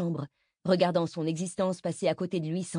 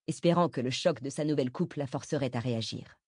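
ordinary delivery, nothing marked

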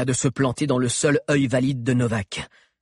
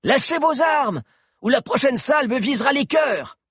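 high, shouted ultimatum; soldier's commanding yell, pitched far up; loud shout; 13 semitones higher than usual. A narrator's reading. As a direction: shout a warning across the water. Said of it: "cria-t-il d'une voix forte".

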